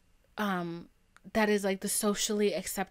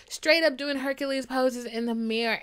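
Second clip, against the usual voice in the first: ordinary delivery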